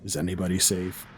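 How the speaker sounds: grizzled and hopeless, voice worn down. Acted. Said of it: "in a grizzled voice of just sheer abandon".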